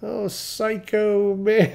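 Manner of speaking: high, slow, drawn-out disbelief; alarmed amused aside. Retold or imitated. Driver to himself about crying passenger, amused alarm. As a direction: stretch it out high, half laughing. Retold private reaction to crying stranger.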